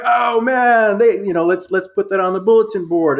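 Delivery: high, loud hammed-up cheer; pumped-up mock rallying cry; mock excitement. Mocking players hyping a bulletin-board insult, loud and excited.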